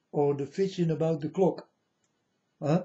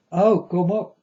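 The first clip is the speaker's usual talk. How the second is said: raised, loud call from above; God calling down; 6 semitones higher, 9 dB louder than usual. Retold or imitated. God calls from the high boat to him, loud and raised.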